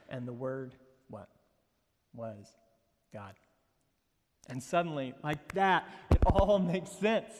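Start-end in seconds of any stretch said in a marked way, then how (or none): 0.1-3.3 hushed, halting reveal with long pauses; suspenseful reading. Shown as a demonstration. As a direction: hold back "Was God", then set it down softly and low. Suspenseful scripture reading before the revelation.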